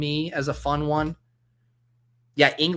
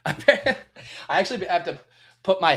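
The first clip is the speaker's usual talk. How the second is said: laughing; 6 semitones higher than usual. Real, not acted. Laughing at sound effects.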